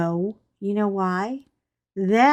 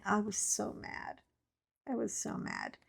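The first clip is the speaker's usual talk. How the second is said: much softer, grumbling under her breath; muttered grumble; 14 dB quieter than usual. Retold or imitated. Sulking over lost shoes, soft muttered grumble. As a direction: mutter it to yourself, still sore.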